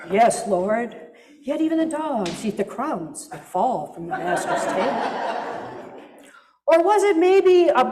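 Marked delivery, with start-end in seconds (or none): 0.1-5.5 dry, reasoning Yoda-like delivery with a small laugh at the end; Yoda logic intonation. Pretend. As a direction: argue it like a smug little Yoda. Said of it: "pharisaical, left brain Yoda logic intonation".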